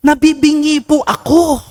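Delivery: high, put-on old woman's voice; old woman complaining. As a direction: play the complaining old lady at mass. (boses ng matandang babaeng nagrereklamo)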